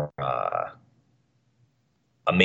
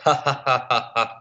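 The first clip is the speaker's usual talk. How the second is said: laughing; 7 semitones higher than usual. Real, not acted. Laughs at pun.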